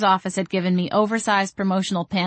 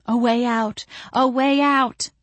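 excited; 5 semitones higher, slower than usual. A narrator's reading. Narrator's eager thought of escape.

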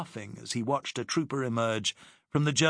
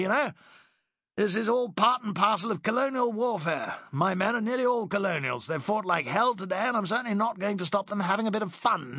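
higher, breezy condescending officer voice; dismissive Driscoll; 9 semitones higher, 3 dB louder than usual. Acted. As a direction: brush him off airily.

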